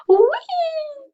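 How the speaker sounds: very high, loud little squeal; weird squeal. Shown as a demonstration. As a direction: squeak it out like a startled toy. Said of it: "I make weird noises".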